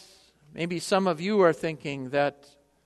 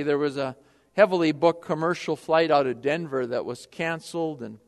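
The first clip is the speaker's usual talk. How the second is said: normal talking voice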